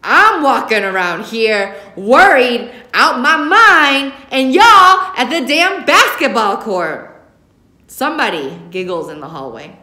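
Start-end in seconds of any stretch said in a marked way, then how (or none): 0.0-7.1 angry mother building to a slow, high shout; furious, drawn-out escalating rant; getting louder each word. A narrator's reading. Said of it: "her voice gets louder with each word".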